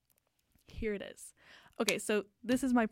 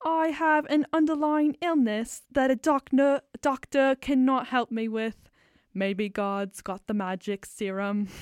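put-on English accent; 2 semitones higher, 7 dB louder than usual. A guy in a tux in a field opening her song, English accent. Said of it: "absolutely needs to be an English accent".

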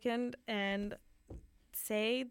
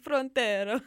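put-on Frontera rolled out in a louder Italian accent; 3 semitones higher, 7 dB louder than usual. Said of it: "in an Italian accent".

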